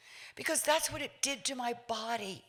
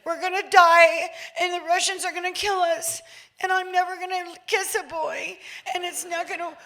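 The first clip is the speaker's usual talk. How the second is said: higher, louder frantic plea; panicked child; 6 semitones higher, 8 dB louder than usual. Retold or imitated. Scared child phoning mom, frantic and panicked. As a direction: beg your mom like the world is ending. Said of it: "I said".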